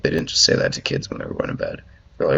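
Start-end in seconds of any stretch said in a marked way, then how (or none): none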